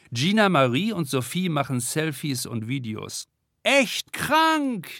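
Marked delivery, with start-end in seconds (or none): 3.6-4.9 high whooping cheer. A narrator's reading. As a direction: whoop it with delight. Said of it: "johlt Gina Marie".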